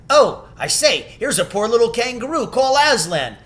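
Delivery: high, quick excited call; excited cry. A narrator's reading. A freed statue spotting the kangaroo, high and excited. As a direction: blurt out the discovery. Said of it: "everyone's voices crying out at once".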